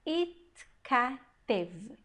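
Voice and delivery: Itkatev very slowly in separate syllables, it, ka, tev; slow reading demo. Shown as a demonstration. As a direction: draw out Itkatev syllable by syllable for learners. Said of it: "como você acha que nós vamos ler".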